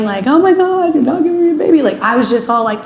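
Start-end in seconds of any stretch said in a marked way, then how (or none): 0.3-1.8 high, hammed-up wail with a laugh; mock crying. Wife mimicking the crying she didn't do, mock wail. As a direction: overplay a whiny complaint, high and louder. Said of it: "it wasn't like I was sitting there crying".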